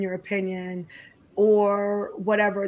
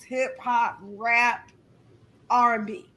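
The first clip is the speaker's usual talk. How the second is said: normal talking voice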